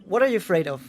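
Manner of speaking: ordinary talk, nothing marked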